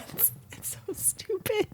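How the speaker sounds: high, soft squeal through laughter; real giggle squeezing the words out slowly; embarrassed laughter. Real, not acted. Laughing before her embarrassing story.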